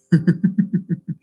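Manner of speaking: high, rapid chuckle; string of quick high laughs; amused giggle. Real, not acted. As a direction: giggle at your own teasing. Laughs about his African accent.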